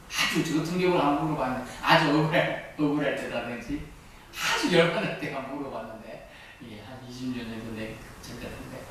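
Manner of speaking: usual speaking voice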